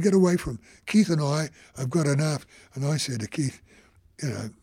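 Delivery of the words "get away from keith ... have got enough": retold angry shoo. Officer shooing reporter away.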